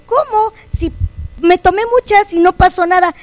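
can't believe nothing happened; incredulous outburst; baffled surprise. Retold or imitated. Surprised pills did nothing.

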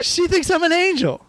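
very high, pleading imitation; hammed-up high-voiced plea; mock panic. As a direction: play the frightened grandson protesting. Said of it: "guy just started fucking panicking".

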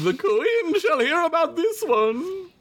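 high, slow prissy gasp of outrage; mock offended lady. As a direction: clutch your pearls, very high.

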